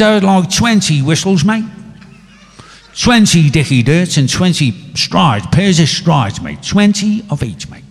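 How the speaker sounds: an Irishman badly faking Cockney slang; mock Cockney. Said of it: "you can do that Cockney bit".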